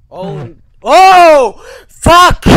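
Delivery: loud high panicked shriek; real fright, full yell; terrified scream. Real, not acted. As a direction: scream as it jumps out. Jump scare, screaming.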